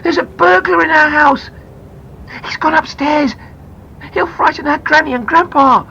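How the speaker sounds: put-on high, loud, urgent boy's shout; alarmed shout. A narrator's reading. Boy reporting a burglar to the policeman, shouted. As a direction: yell the alarm out the window. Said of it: "shouted Peter".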